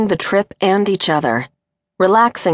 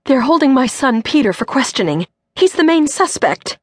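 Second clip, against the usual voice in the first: higher, strained distress climbing; barely getting words out; 8 semitones higher than usual. Acted. Mother telling Cindy her son is suspected, strained. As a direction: force out the bad news. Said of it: "could barely get the words out".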